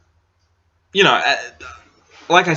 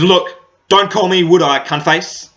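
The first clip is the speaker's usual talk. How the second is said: louder, mock-irritated punchline; mock annoyed retort; 5 semitones higher, 4 dB louder than usual. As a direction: snap it back as the joke's payoff. Punchline guy snaps at girl.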